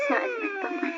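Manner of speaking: high, soft tearful whimper; crying softly. Acted. Wife in labor whimpering and crying to her mother. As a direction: sob the question out.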